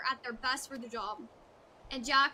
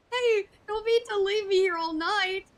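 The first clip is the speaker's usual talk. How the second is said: higher, louder plaintive plea; a hint of a stranded man begging; pleading whine; 5 semitones higher, 7 dB louder than usual. Underfoot hanging, pleading.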